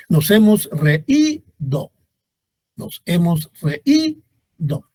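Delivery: "reído" slowly, re-Í-do; hiatus demo. Shown as a demonstration. Teacher showing there is hiatus, slow split "reído". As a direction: separate E from stressed Í in "reído". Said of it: "Ahí hay hiato".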